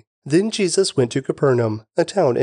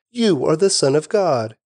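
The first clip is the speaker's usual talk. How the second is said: ordinary delivery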